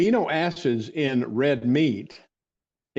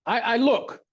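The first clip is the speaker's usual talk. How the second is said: silly voice; 8 semitones higher than usual. Retold or imitated. Recalling the barefoot doctor at his bedside, in a higher silly voice. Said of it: "the silly voice I'm doing".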